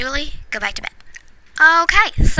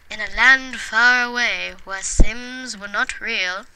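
mock storybook; 5 dB quieter than usual. Inventing the Sims bedtime story, soft mock storybook telling. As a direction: read it like a fairy tale to a child.

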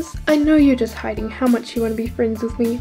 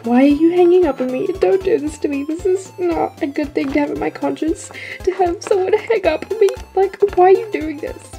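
fast, higher-pitched outraged rant; indignant protest; 5 semitones higher, faster than usual. Acted. Popular girl protests being hung up on, fast indignant rant. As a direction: rush out an affronted plea.

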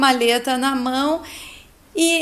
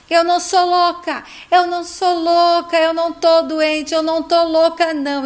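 higher, louder acted outburst; losing control, shouting; 5 semitones higher, 5 dB louder than usual. A narrator's reading. Heloísa insists to psychiatrist and sister she isn't crazy, shouting. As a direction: protest loudly as you lose control. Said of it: "começa a se descontrolar e gritar".

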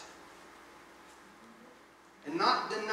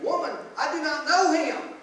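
loud, higher retold protest; loud denial; 8 semitones higher, 10 dB louder than usual. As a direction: push back hard on the accuser. Peter's denial, acted.

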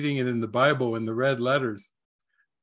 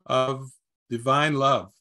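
normal talking voice

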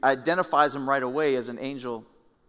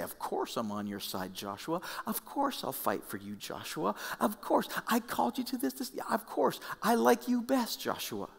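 imagined reassuring Jesus; 9 semitones higher, 4 dB quieter than usual. Pretend.

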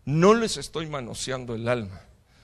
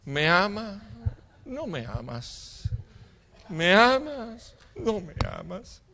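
mock lovelorn prayer; 5 semitones higher, slower than usual. Praying people pluck a rose at God, mock lovelorn. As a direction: pull petals, drawn out and hammed-up. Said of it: "en la oración agarran una rosa".

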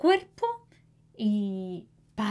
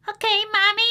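put-on high, louder little boy voice; child voice; 10 semitones higher, 5 dB louder, much faster than usual. A narrator's reading. Narrator voicing Manuelito answering mom, high childish voice. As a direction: chirp it like an eager small boy. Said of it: "Manuelito dice".

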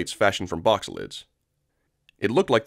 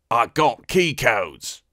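bargaining alien, drawn out; Weequay voice; much slower than usual. Acted.

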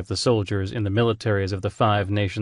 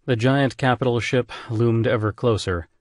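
plain reading voice

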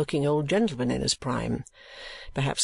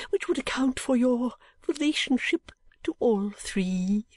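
put-on high, theatrical old gentleman pressing on; great anxiety; 10 semitones higher than usual. A narrator's reading. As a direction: list the titles in an anxious high voice. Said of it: "inquired the old gentleman with great anxiety".

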